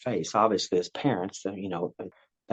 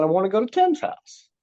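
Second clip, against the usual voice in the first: mock whiny child; 10 semitones higher, 3 dB louder than usual. Child voiced, obvious answer.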